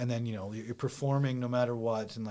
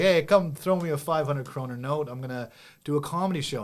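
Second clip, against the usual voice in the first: loud, higher sales pitch to passers-by; calling out; 4 semitones higher, 6 dB louder than usual. Acted. Comedian touting a cheap show to punters, calling out. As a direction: call out to drum up an audience.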